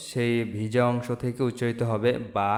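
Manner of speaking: normal talking voice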